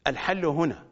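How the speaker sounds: normal talking voice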